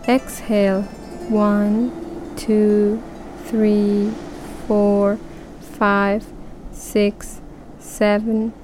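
unhurried counting with pauses; slow breath counting. Shown as a demonstration. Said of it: "Now we'll make the count longer".

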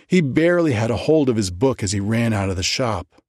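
usual speaking voice

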